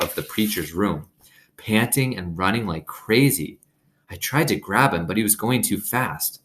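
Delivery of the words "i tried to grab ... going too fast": plain reading voice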